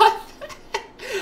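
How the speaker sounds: high airy burst of laughter; cracking up at the made-up name; high airless laugh; high breathless laugh. Real, not acted. Laughing at his own joke.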